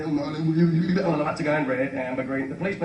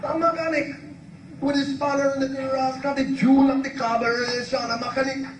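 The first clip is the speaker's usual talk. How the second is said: very high put-on character voice; exasperated high-pitched motorist act; Chalky character voice; 9 semitones higher than usual. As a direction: explain it in a high, comic caricature. Chalky explains the word to policeman.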